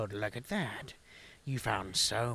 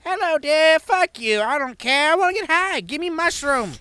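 put-on old granny voice; 12 dB louder than usual. Mock grandma voice, high shrill.

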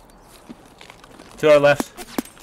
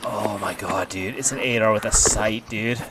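ordinary talk

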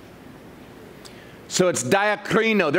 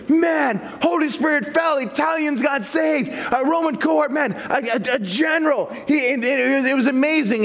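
higher, bursting with news; excited report; 6 semitones higher than usual. Retold or imitated. Peter telling the church back home, excited. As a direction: gush about the miracle, tripping over words. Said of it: "They come back saying".

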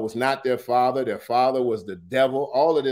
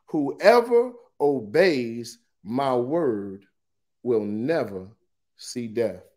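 weighty declaration; slower than usual. Acted. Preacher reads Jesus's promise to listeners, slow and weighty. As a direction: let each phrase land separately. Repeats the promise after "Whoa.

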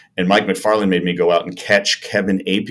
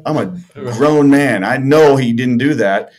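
louder, higher protest, words stretched out; retold indignation; 6 semitones higher, 5 dB louder than usual. Player fuming to himself about the manager, retold indignation. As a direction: protest like a wronged grown man.

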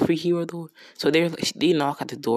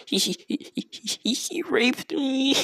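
mock crying; 11 semitones higher than usual. Girl accusing the hero behind Moriyasu, fake crying. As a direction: whimper it out in a hammed-up high voice. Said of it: "He's crying behind Moriyasu".

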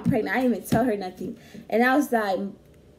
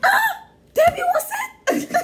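much higher, squeaky shocked voice; mock shocked mother; 6 dB louder than usual.

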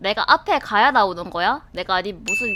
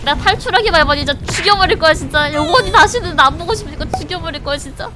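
angry threat; 6 semitones higher, 5 dB louder, faster than usual. Real, not acted. Threatening the game villain.

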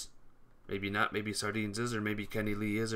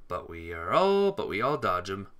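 ordinary talk, nothing marked

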